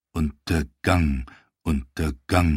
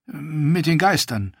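blurted out; 10 semitones higher than usual. Acted. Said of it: "platzte es aus ihm heraus".

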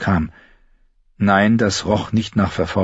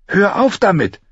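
put-on sharp higher girl's hiss; 13 semitones higher, 4 dB louder than usual. Girl hisses at Gendry to stop mocking, higher. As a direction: snap at him to stop. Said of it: "zischte Arya".

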